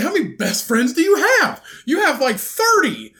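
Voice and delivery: high loud disbelief; incredulous retort. Retold or imitated. Retelling his disbelieving reply to that friend. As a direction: scoff at thirty best friends. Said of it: "I'm like".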